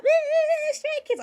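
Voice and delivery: high squeal of joy; excited fangirl squeal. Retold or imitated. Fan at the concert squealing about Stray Kids, high and thrilled. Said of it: "I'm like, like".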